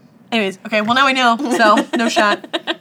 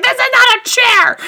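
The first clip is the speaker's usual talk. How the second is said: high, loud indignant yell; furious shout; 10 dB louder than usual. Retold or imitated. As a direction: shout it, laughing at the scene. Retelling Doris's shout again.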